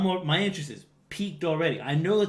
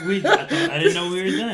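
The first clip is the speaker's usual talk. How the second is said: higher, louder, talking through laughter; laughing; 5 semitones higher, 7 dB louder than usual. Real, not acted. Guest caught out by the host on the missed reply, laughing. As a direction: let the laugh run through the words.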